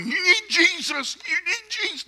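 mock preachy nagging, pitched high; hammed-up nag pressing Jesus on strangers; pushy street preacher. Preacher mocking pushy believers, in a high hammed-up nag. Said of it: "those who go around going".

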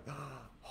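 a soft, breathy pretend gasp of shock; mock shocked gasp. Mock shocked gasp as God reacting to a sin. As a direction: gasp as if scandalized, playing God in mock horror. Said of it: "that God's like".

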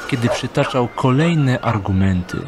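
usual speaking voice

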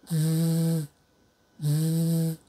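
ズー as a long, level consonant buzz; voiced fricative. Shown as a demonstration. Teacher drills number four for repetition, ズー held as a voiced buzz. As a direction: keep the voice humming through the friction of ズー. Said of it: "二つ目の黒い方は有声音で".

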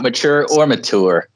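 mature first "ma-CHOOR", then "ma-TOOR"; two pronunciations. Shown as a demonstration. Joking he is neither, mature said both ways. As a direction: switch "mature" from CH to hard T. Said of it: "discussion one day about the word mature".

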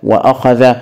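"وأخذ" said "wa-akhadha" with a catch after wa; hamza pronounced. Shown as a demonstration. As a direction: sound the glottal stop in "وأخذ". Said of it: "ننطقها بهمزة ونقول". (نطق «وأخذ» مع تحقيق الهمزة بعد الواو)

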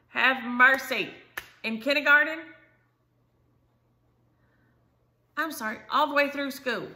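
loud disbelieving grandma; exasperated outburst. Real, not acted. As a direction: throw up your hands, loud.

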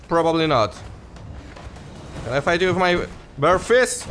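ordinary talk

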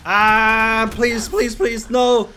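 loud, high panicked pleading; scared horror game streamer. Real, not acted. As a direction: beg for your life in panic. Being killed in horror game.

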